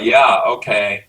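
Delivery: slow, lightly drawn-out "Yeah, okay" in a hint of American; a light imitation of mum's American phone manner. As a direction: mum answering the phone, lightly imitated in her American accent. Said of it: "my mother had an American phone voice".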